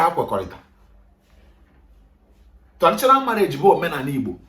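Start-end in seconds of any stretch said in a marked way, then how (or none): none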